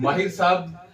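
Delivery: usual speaking voice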